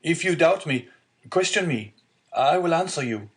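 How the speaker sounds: high, urgent pleading; distressed young man's high-pitched appeal; unhappy cry. A narrator's reading. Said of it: "cried the unhappy young man".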